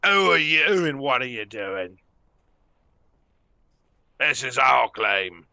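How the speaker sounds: loud gruff bugbear shout; bugbear chief voice. Acted. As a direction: bark it like a monster boss. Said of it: "looks over at you all and goes".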